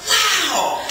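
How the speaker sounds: high, loud delighted exclamation; excited wow. Retold or imitated. Mimicking the homeless man thrilled over coins. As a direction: burst out thrilled. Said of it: "he got so excited".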